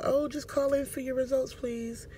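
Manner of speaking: high, soft receptionist voice; routine office caller. Retold or imitated. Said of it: "It was a regular call".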